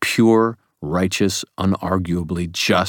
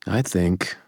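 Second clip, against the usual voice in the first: ordinary delivery, nothing marked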